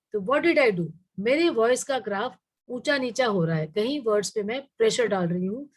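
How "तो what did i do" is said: normal talking voice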